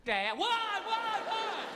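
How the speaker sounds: put-on high, loud whiny little boy; crying child. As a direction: wail it like a scared small kid. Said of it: "He'd be like".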